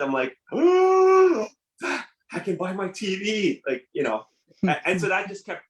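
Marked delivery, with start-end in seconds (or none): 0.5-1.5 long, loud, very high falsetto "oh"; sustained high gasp of mock wonder; awestruck "ooh". Teen seeing monthly money promised, mock awestruck "ooh". 1.8-3.6 high, drawn-out dreamy exclamation; mock awestruck delight. Teen realizing he can afford a TV, mock awestruck delight. Acts out delight at money promise.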